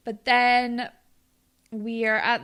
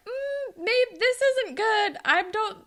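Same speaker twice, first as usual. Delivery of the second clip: imitating high-pitched hesitant doubt; uneasy; 10 semitones higher than usual. Retold uneasy first doubts.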